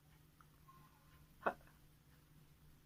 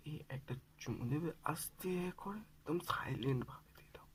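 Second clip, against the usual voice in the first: furtive, coaxing whisper; barely voiced sweet talk on the phone; 3 semitones lower, 13 dB quieter than usual. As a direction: whisper a secret request to a lover. Secret kiss request, mother nearby.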